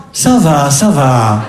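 low, falling martyred groan; mother's guilt-trip rasp. Retold or imitated. Guilt-tripping mother on the phone, low martyred groan. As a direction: sigh it like a suffering mother. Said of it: "elle change sa voix. Elle a un râle".

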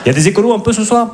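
a quick showbiz host shout-out; emcee call-out. Acted. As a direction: whip up the audience. Crowd-work call to audience.

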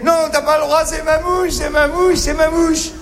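plus aigu, il répète en montant jusqu'au cri; un petit qui défend sa mouche, de plus en plus fort; petit mâle jaloux. Acted. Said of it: "comme s'il disait". (le petit mâle défend sa proie, de plus en plus fort)

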